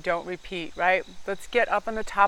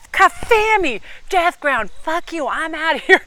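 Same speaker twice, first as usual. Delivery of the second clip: high, loud imagined blow-up at the boss; defiant shout; 10 semitones higher, 8 dB louder than usual. Pretend. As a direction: yell it as you storm out. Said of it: "I didn't say that".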